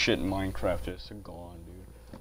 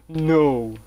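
mock cry of no; 7 semitones higher, 9 dB louder than usual. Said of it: "I'm like".

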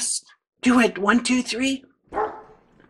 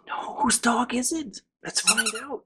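whisper; 5 semitones higher, 5 dB quieter than usual. Acted. Whispered storytelling.

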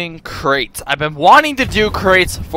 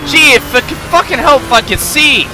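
frustrated outburst; 7 semitones higher, 4 dB louder than usual. Real, not acted. Frustrated at view.

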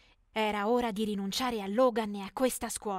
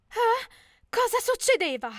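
much higher, loud bewildered cry; confused surprise; 11 semitones higher, 8 dB louder than usual. Acted. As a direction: squeak out total confusion. Confused at the proposal scene. (stupore confuso, molto acuto)

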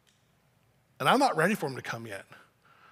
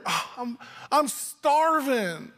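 put-on griping child, stretched-out higher whine; complaining kid; 5 semitones higher, much slower than usual. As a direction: gripe slowly like a starving kid. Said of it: "you're complaining and you're griping".

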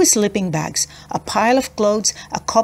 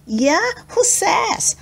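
high, scornful challenge; defiant; 11 semitones higher, slower than usual. Acted. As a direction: sneer the question up high. Simon defies Roxy.

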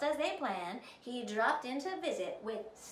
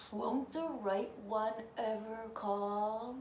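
wistful prince. A narrator's reading. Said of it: "Prince Charming wasn't happy".

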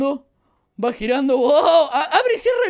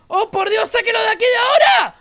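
panicked shout; 9 semitones higher, 7 dB louder than usual. Real, not acted. Alarma en la mina.

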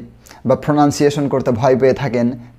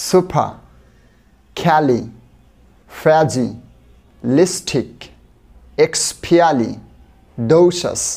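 very slow, one chunk at a time with pauses; split into syllables; much slower than usual. Shown as a demonstration. Teacher breaking a word into chunks for students, very slow. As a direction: break the word apart slowly for learners. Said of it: "আস্তে আস্তে বলি, চলুন".

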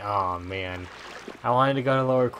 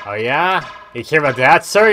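loud, raised-pitch taunt; mock confrontation; 6 semitones higher, 11 dB louder than usual. Streamer taunting a game character, mock confrontation. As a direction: challenge him, getting louder.

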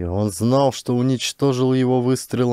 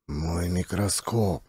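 numb murmur; 4 semitones lower, 7 dB quieter, slower than usual. A narrator's reading. Dazed man answers numbly.

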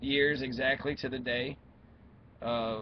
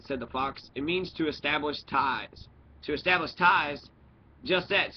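story narration; 2 semitones higher, 4 dB louder than usual. A narrator's reading. Reader telling of the fox meeting the little prince, animated storytelling. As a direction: read a storybook with animated character lines.

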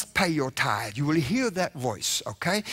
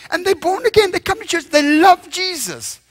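mock churchgoer; 13 semitones higher, 6 dB louder than usual. Preacher mocks churchgoers' piety.

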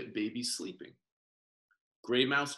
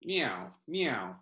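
cat meow. Acted. Cat sound in story.

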